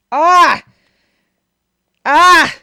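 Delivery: high, loud cry of shock; shocked yelp. Real, not acted. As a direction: yelp at a gruesome sight. Startled by a gory clip.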